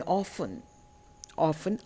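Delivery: "orphan" with no R sound, British style; silent R. Shown as a demonstration. Teacher showing British pronunciation of "orphan", R silent. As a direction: say "orphan" as aw-fun, R dropped. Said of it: "R is not pronounced in British pronunciation".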